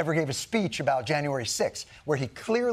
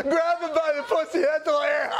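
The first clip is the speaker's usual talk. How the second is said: high, loud laughing words; laughing hysterically; 12 semitones higher, 5 dB louder than usual. Retold or imitated. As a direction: howl the words through laughter. Imitating a crowd laughing along.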